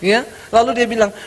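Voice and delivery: usual speaking voice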